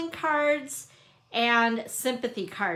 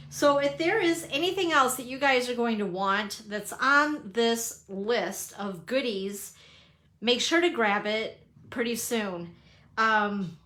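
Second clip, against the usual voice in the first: ordinary talk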